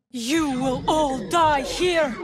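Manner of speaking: slow, booming menace; slow shouted threat from a villain; menacing threat. Acted. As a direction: loom over them and promise death. Villain threatening during battle.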